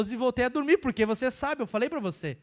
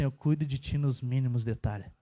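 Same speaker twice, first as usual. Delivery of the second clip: much lower, hushed voice of God; God's voice; 9 semitones lower than usual. Retold or imitated. God reassures him again, deep hushed voice. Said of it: "Deus falou assim".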